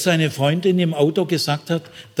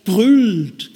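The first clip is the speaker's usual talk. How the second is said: building to a roar; 7 semitones higher, 3 dB louder, much slower than usual. Shown as a demonstration. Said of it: "könnte man fast übersetzen".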